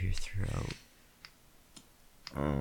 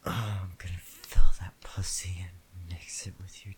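whispering; 8 dB louder than usual. Acted. Intimate whispered roleplay.

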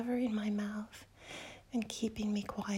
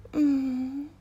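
loud moan; 9 dB louder than usual. Acted. Moan in intimate scene.

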